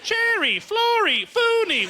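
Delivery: very high, sing-out name calls; kids' show characters. Acted. Comic listing Pee-wee's Playhouse characters, silly high voice. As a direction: call each name high like a puppet show. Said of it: "characters from Pee-wee's Playhouse".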